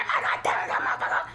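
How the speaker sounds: unvoiced mock rant; imitating fussing auntie. Auntie cussing over her nephew being spit on, mock rant. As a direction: rattle off a mock cussing-out under the breath. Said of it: "she gonna be like".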